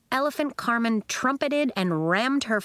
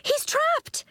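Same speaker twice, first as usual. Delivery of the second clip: put-on alarmed girl. A narrator's reading. Nori alarmed.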